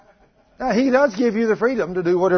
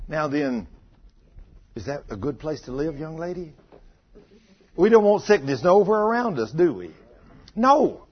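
ordinary delivery

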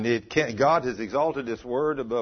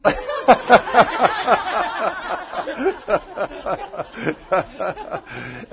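high, loud, long belly laugh; real laughter; 7 semitones higher, 5 dB louder than usual. Real, not acted. Preacher cracking up at remark from congregation, real laughter. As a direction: burst out laughing and keep going.

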